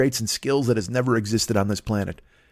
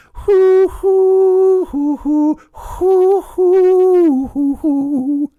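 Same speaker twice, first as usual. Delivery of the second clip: high, loud breathy hoo hoo like an air flute; high flute hoots; 6 dB louder, much slower than usual. Pretend. Comic playing air lute, hooting a flute tune high and loud. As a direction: mimic a flute with piercing hoots. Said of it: "air fingering it right now".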